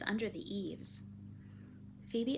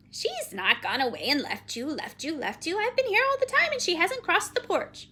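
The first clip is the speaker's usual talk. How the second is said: repeating phrases, rising to high and loud; brisk, scolding Penelope; 8 semitones higher, 8 dB louder than usual. Acted. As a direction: scold with a squawky repeat, building higher. Figure of Penelope.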